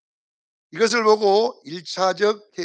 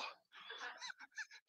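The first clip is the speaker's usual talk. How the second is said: retold through laughter, voice softer; laughing; 7 dB quieter than usual. Real, not acted. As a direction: laugh the words out. Laughing while retelling. (웃으면서)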